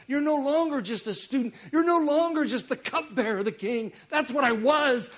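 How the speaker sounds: loud, high-pitched urgent exhortation; full-force preaching. Real, not acted. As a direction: press the message home to the congregation.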